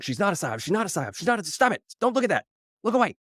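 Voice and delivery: from a flat denial up to high, fast flustered pleading; mock panicked denial. Podcaster mocking outlets that deny, rising into fluster. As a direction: start insisting, then panic and shoo them away.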